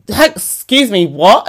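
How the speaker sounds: ordinary talk, nothing marked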